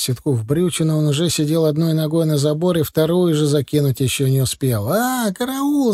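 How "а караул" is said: high, drawn-out cry of alarm; acted scream at contained volume; yelling for help. A narrator's reading. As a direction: wail for rescue, slow and high. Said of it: "заорал Титок".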